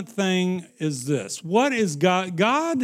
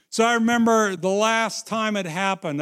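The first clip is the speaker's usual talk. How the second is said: ordinary talk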